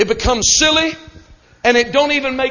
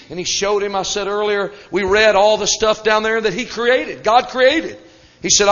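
normal talking voice

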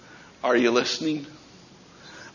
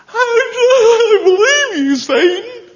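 high, loud, drawn-out fake crying; hammed-up sobbing wail of surrender; mock wailing; 8 dB louder, slower than usual. Acts gullible believer wailing to Satan.

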